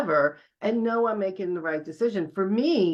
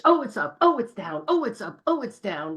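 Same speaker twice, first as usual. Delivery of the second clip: higher-pitched little exclamations, each falling away; mock market-watcher fretting; 5 semitones higher than usual. Investor watching a stock price bounce, mock fretting. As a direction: mimic a jumpy investor reacting.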